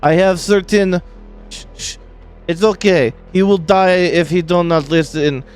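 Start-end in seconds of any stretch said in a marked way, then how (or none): 1.5-2.0 soft hush. Acted. Russian character hushing the frightened captive. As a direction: shush him gently.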